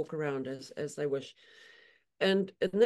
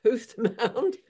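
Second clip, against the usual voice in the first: laughing self-correction, higher and louder; 6 semitones higher, 5 dB louder than usual. Real, not acted. Correcting herself with a laugh. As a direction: laugh through the corrected word. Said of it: "it's a hoof".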